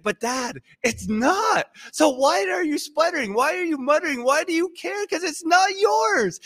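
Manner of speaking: high-pitched protest to Dad, climbing as it goes; exasperated pleading. Retold or imitated. Acts out exasperated plea to dad.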